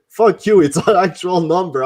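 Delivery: high, loud retort; mock angry. Caller insisting it is Natasha's number, mock angry. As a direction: imitate an irate caller pushing back.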